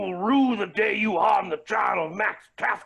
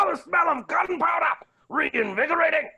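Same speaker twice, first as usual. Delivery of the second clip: excited shout; 8 semitones higher than usual. Acted. Revived by gunpowder smell.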